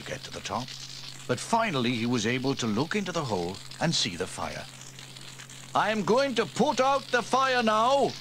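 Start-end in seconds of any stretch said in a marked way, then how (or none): 5.7-8.1 much higher, louder call from the spire; shouting down. Acted. Sam shouts down to the crew below, loudly. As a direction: call down from up high. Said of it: "he shouted to the others".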